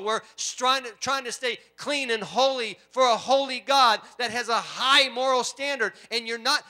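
loud, high, emphatic pulpit preaching; full-force preaching. Real, not acted. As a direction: drive each phrase home to the congregation.

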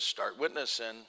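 low, soft, quiet command; Holy Spirit's prompting. Retold or imitated. Holy Spirit prompting the preacher while field dressing, low and soft. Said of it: "the Lord says".

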